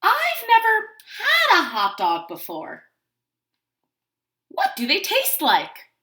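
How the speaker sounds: high, slow, loud little voice; piping drawn-out cartoon chick; innocent duckling. A narrator's reading. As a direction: ask slowly as a wide-eyed duckling. Voicing the duckling's innocent question.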